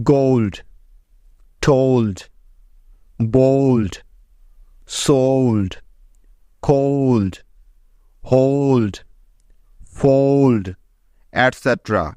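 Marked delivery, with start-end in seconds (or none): 0.1-10.6 গোল্ড, টোল্ড, বোল্ড said slowly and one by one, each ও gliding into ঔ; example words with ou. Shown as a demonstration. Teacher reads out each example word to the class with a clear ঔ sound. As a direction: model the ঔকার glide in every word, pausing between them. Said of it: "প্রথমে এলডি এর কিছু উদাহরণ".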